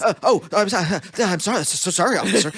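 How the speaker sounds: bumbling, stammering apology to an officer; flustered, halting English-gent politeness; Hugh Grant. Retold or imitated. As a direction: apologize over and over, tripping on your words. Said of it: "upped my, like, Hugh Grant levels".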